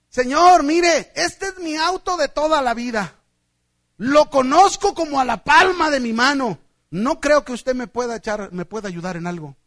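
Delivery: proud owner who needs no help; dismissive mechanic. Acted. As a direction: brush off a stranger's offer of help. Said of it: "el mecánico se sonrió y dijo".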